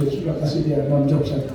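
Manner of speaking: ordinary talk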